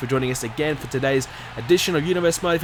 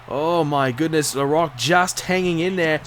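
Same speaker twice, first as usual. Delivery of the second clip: ordinary talk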